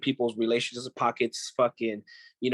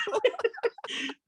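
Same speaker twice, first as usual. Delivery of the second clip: high-pitched burst of laughter. Real, not acted. Laughing while retelling job loss.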